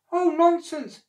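high, loud brush-off; enormous lady covering for Jack; dismissive lady. Acted. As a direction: wave it away nervously. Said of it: "said the enormous lady".

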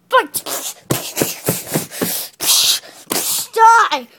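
loud high battle yell; shouting. Pretend. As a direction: yell it into the ring. Announcing toy fight.

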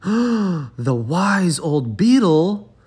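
high, slow, awed gasp; gasping animals. Acted. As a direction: animals awed at the idea of fetching wise old Beetle. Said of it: "gasped all the animals".

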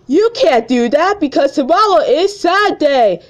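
high, loud teacher voice in a put-on timbre; teacher impression. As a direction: play a bossy teacher scolding the class. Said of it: "Yes, I'm gonna do the impressions".

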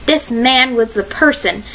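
ordinary delivery, nothing marked

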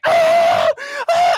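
very high, loud scream; all screaming in terror together; group screaming. Retold or imitated. Four friends panic, screaming very high and loud. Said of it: "four of us are like".

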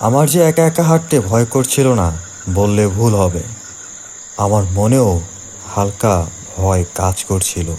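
ordinary delivery

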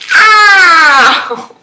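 loud, high drawn-out "aah"; imitated noise. Mimicking a friend's habitual noise, loud imitated cry. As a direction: yell out a mock cry, falling in pitch. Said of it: "that noise you always make".

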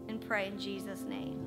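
ordinary delivery

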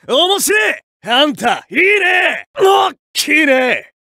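battle-cry super move. Acted. Fighter unleashing his special attack on the rival, exultant shouts. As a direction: call out the finisher with relish.

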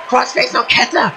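ordinary talk, nothing marked

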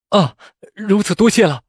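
high elated thanks; joyful, loud. A narrator's reading. Rescued candidate thanking his benefactor, loud and joyful. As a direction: burst out happily with gratitude. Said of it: "大声笑道".

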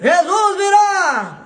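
drawn-out high preaching cry, slow; preacher's shout. Retold or imitated. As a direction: proclaim it like a pulpit preacher. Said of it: "o pastor começou a gritar". (grito de pastor pregando, arrastado e agudo)

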